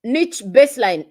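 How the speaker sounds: ordinary talk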